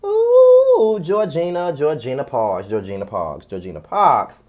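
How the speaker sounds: disapproving, descending refrain; exasperated name-calling. Real, not acted. Exasperated repeating of her name.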